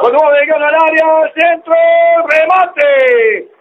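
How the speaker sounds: grito de relato, agudo y urgente; relato emocionado. Real, not acted. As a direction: sube la voz ante el peligro de gol. Jugada de peligro en el área. (relato de fútbol vibrante)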